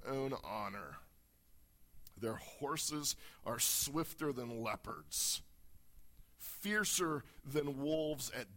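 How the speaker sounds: solemn, declaiming reading; dramatic scripture reading. Acted. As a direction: read the prophecy with gathering dread.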